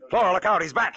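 high, loud alarm call; urgent warning shout. Acted. Detective faking an alarm to distract Flora, urgent shout. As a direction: shout a warning to trick her.

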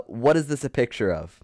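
ordinary talk, nothing marked

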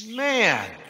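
grumbling cat to himself. A narrator's reading. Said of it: "Montana said to himself".

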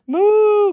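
high, loud playful cow moo. A narrator's reading. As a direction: belt out a silly cow moo. Narrator imitating farm animals.

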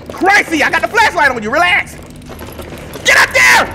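rising to very high, loud screams; frantic shouting. Real, not acted. Player holding flashlight on Chrissy, frantic screaming. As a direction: scream orders in a panic. Yelling at game enemy with flashlight.

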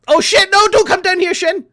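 high, loud, hammed-up posh shout; mock panic shout. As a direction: yell in comic posh panic.

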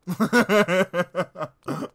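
loud, high, sustained laughter; long laugh. Real, not acted. Laughing at the joke.

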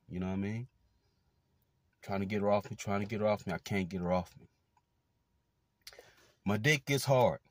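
ordinary talk

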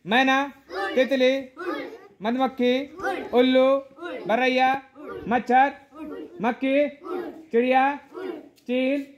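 loud, high game calls to the class; calling out. Real, not acted. Teacher calls out game words.

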